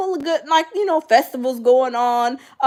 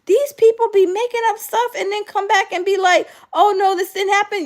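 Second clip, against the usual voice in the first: indignant; 7 semitones higher than usual. Retold or imitated. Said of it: "I'm like".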